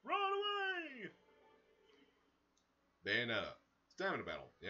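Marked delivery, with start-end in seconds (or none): 0.1-1.1 very high, loud, drawn-out call; high-pitched mock cry. Player backing out of a choice, high mock cry. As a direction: stretch it into a mock squeal of escape.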